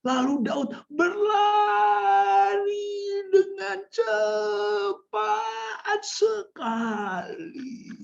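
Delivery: extremely drawn-out, high-pitched vowels; slow motion. Shown as a demonstration. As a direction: tell it as if the runner moves in slow motion. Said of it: "Gitu ya, slow motion".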